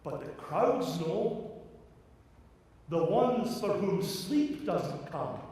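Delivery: high, loud, fervent preaching; impassioned preaching. Acted. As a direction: preach it with rising fire.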